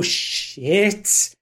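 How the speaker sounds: high-pitched rising dismayed curse. Acted. Man gripping his hairline, dismayed. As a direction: let the dread rise through the word. Game master acts a dismayed character.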